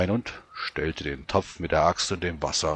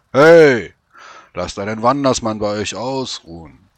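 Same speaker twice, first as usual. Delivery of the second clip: calling out; 4 semitones higher, 13 dB louder than usual. Acted. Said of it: "bat er".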